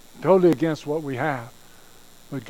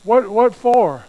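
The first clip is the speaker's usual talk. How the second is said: loud, slow, higher mock whine; suspicious recruit; 6 semitones higher, 6 dB louder, slower than usual. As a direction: drag out the doubt. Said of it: "the guys go".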